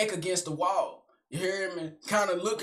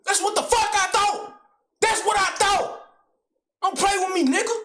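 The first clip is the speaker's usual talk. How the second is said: much higher, loud triumphant yell; gloating shout; 11 semitones higher, 4 dB louder than usual. Retold or imitated. Uncle gloating at the narrator, a much higher loud yell. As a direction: crow over the win.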